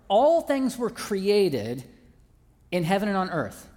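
"All things were created" spoken very slowly, each word weighted; "All" stressed, slow and deliberate; slow emphasis. Shown as a demonstration. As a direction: lay out the phrase word by word for the church. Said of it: "let's start with that first part".

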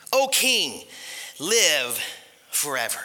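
measured address to a king, pausing between words; lightly voiced solemn reply, sinking low at the end; lightly acted Daniel. Daniel answering the king from the den, lightly acted and measured. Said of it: "Then Daniel said to the king".

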